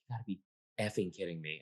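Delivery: low, muttered disbelief under the breath; a quiet girl grumbling to herself, half aloud; quiet grumble. Retold or imitated. Quiet girl at party grumbling over her lost squares, muttered.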